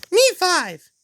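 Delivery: very high squeaky character voice; Zoe. Acted. Zoe joins the others against Jalen, squeaking high. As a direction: pipe up in falsetto. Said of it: "Zoe says".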